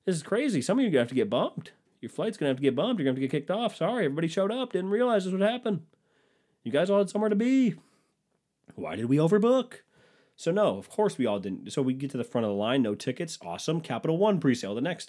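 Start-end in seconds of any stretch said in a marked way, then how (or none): 0.0-9.8 high, mock-innocent airline agent; chirpy fake apology, pitched high. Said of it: "It's like the airline".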